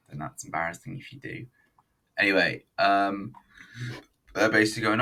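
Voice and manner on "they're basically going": ordinary talk, nothing marked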